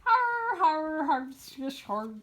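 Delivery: hammed-up evil chuckle fading out; cartoon dinosaur's evil laugh. A narrator's reading. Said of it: "sounded like a big evil laugh".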